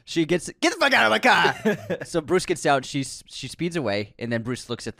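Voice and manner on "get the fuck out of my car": high yell through laughter; laughing shout. Retold or imitated. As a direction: shout it, cracking up. Quoting Rachel, laughing.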